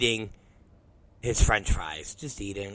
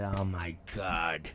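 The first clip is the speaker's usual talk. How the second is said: low, slow, quiet groan of words; exhausted dismay; 9 semitones lower, 12 dB quieter, slower than usual. Retold or imitated. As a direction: let it drop, deep and worn out. Exhausted reaction after the story.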